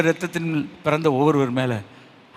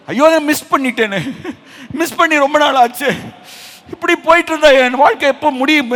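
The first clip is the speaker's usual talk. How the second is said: tearful lament; 11 semitones higher, 6 dB louder than usual. Acted. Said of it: "உங்கள் கண்களில் கண்ணீர் வடிகிறதா".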